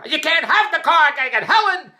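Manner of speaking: high, loud mock yell; retold scream. Wife refusing him the car, loud mock yell. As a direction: shout it back like an angry wife. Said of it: "I let her scream the whole time".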